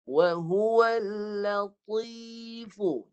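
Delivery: Wa huwal drawn out very slowly in Quranic recitation. Shown as a demonstration. Teacher models Quran recitation for the student. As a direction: chant the verse slowly, heavy on the letters. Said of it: "Cuba baca".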